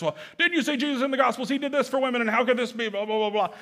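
quick, high mock voice of a doubter; mock objector. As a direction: parody the people who argue back. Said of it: "Most people use that as".

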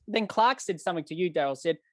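ordinary talk

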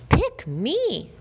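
high, bright begging cry; a puppy begging to be chosen; eager plea. A narrator's reading. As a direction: beg to be picked, pitch way up.

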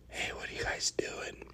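hushed whispered greeting; very soft, sneaky whisper. Retold or imitated. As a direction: whisper it to a small group. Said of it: "You should try whispering".

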